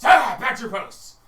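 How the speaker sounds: loud, high commanding yell; barked order. Acted. Guard leader yells at huddling guards, loud. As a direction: order the guards back sharply. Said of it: "the one guy goes".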